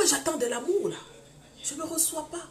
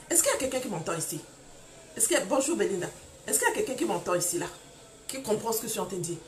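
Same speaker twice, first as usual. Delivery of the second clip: rapid appeal; 4 semitones lower, faster than usual. Real, not acted. Appeals to the audience.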